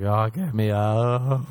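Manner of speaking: slow, drawn-out groaned plea; mock panicked plea. Boy trapped in closet chest begs release, drawn-out mock plea. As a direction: drag out a retold cry for help. Freaking out.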